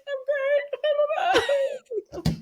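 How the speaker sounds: high tearful wail, laughing through it; mock crying. Runner retelling her tearful answer to German spectators, mock crying. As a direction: squeal it out through mock sobs. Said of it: "I'm, like, crying".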